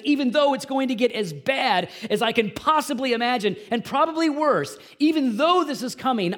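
high and loud, building defiance; Habakkuk loudly vowing to trust God despite coming ruin; impassioned declaration. Retold or imitated. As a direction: declare it with rising passion. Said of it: "He says".

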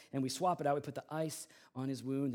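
normal talking voice

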